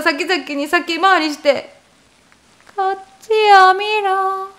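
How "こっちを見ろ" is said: a slow, higher, otherworldly call; eerie ghost voice. Retold or imitated. Ghost woman appearing before him in the dream, slow eerie call. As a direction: lure him in, drawing out each word. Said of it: "って言ってて".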